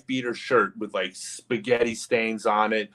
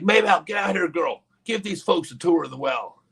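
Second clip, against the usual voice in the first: high Southern holler, easing into an order; Southern accent; 8 semitones higher than usual. Retold or imitated. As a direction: summon the girl in a Southern twang. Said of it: "It was a Southern accent".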